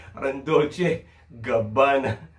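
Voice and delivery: slow, drawn-out Dolce with a fall, mimicking the rapper's stress; mock emphasis. Watching a battle, slowly mimicking the rapper's emphasized rhyme. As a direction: stretch out "Parang Dolce Gabbana" the way the rapper hammed it. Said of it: "Ini-emphasize pa".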